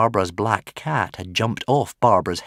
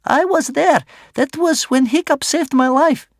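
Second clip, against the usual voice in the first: put-on very high, loud child voice; little child calling out; 9 dB louder than usual. Little wanderer vouches for Hiccup, calling out high. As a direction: pipe up loudly as a small child. Bear Cub keeps calling out.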